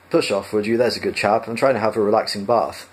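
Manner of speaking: plain reading voice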